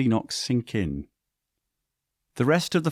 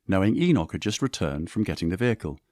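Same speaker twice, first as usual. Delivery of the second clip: plain reading voice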